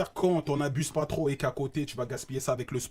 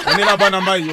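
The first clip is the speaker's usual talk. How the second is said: excited shout-out; 5 semitones higher, 12 dB louder than usual. Acted. Shout-out to Congo.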